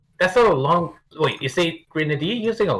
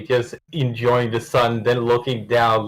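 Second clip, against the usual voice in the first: ordinary delivery, nothing marked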